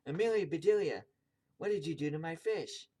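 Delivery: loud, high demand; outraged storybook scolding; angry shout. A narrator's reading. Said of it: "he shouted".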